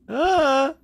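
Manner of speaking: startled yelp. Real, not acted. Startled by ghost in dark.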